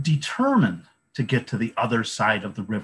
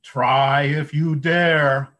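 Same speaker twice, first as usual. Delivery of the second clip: slow, louder goat challenge; bellowing big goat; 4 semitones higher, 6 dB louder, slower than usual. A narrator's reading. Biggest goat bellows a challenge back at the troll, slow. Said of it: "the biggest goat bellowed right back".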